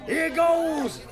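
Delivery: high, hammed-up pirate growl. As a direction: ham it up like a party pirate. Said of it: "Why are you talking like a pirate?".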